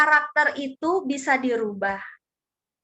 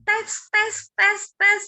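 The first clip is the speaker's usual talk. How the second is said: high, evenly spaced drips; staccato imitation of falling drops; dripping water imitation; 6 semitones higher, much slower than usual. Shown as a demonstration. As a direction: tick out each drop in a raised pitch. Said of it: "batu ditetesin air".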